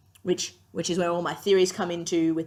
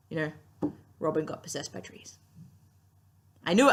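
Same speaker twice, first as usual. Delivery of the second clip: ordinary talk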